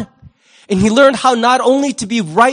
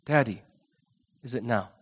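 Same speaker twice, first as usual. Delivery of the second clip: much softer and lower, almost to himself; hushed repeat; 9 semitones lower, 12 dB quieter than usual. Retold or imitated. Pastor echoing Jesus' prayer to the Father, hushed and low. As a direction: let the question sink to a hush.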